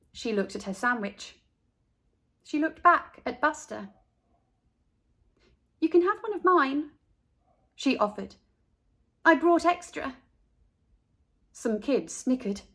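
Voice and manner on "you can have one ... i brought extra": higher, gentle offer; kind offer. A narrator's reading. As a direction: share with the hungry boy. Carla kindly offers Buster food.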